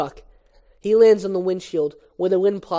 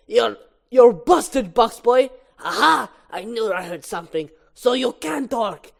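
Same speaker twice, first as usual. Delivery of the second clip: higher, slow, punchy accusation; triumphant gotcha; 4 semitones higher, 4 dB louder, slower than usual. Acted. Barry catches Hector out, triumphant. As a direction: pounce on the culprit, drawing out the words.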